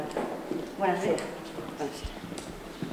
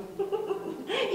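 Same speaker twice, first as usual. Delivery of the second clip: giggle; 9 semitones higher, 8 dB quieter than usual. Acted.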